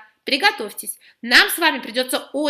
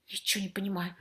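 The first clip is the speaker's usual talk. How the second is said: much softer, lower mumble; muttering; 5 semitones lower, 13 dB quieter than usual. Acted. Vladislava Konstantinovna mutters to herself, baffled. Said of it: "пробормотала Владислава Константиновна".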